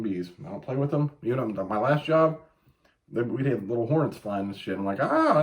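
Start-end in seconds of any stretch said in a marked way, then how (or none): 5.0-5.4 high, loud squeal of "Ah"; retold squeal. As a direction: squeal like a grown man scared of hornets. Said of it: "I'd fucking run and squeal".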